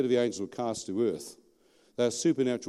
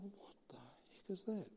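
very quiet, slow whispered disbelief; stunned whisper; 8 semitones higher, 20 dB quieter, slower than usual. Retold or imitated. Man watching the woman thrown down, whispering in shock to himself. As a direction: whisper it slowly to yourself, aghast. Retells his stunned thought.

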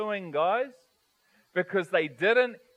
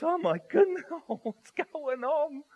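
much higher, slowing into a nervous laugh; bewildered surprise; 7 semitones higher than usual. Acted. Preacher voicing the perplexed women at the tomb, startled. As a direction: gasp it out, then laugh in disbelief. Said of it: "while they were trying to figure this".

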